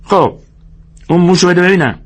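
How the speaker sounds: high-pitched sharp demand. A narrator's reading. George demands the mouse from Lennie, sharp and high. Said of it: "جورج به تندی گفت".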